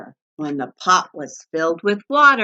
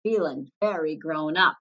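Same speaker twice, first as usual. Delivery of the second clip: usual speaking voice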